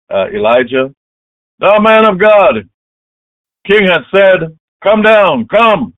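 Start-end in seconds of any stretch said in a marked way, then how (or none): 1.6-5.9 high, loud captain calling up a hill; shouted command. Acted. Captain summoning Elijah from the hilltop, shouted command. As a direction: order him down at full voice.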